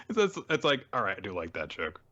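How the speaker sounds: ordinary talk, nothing marked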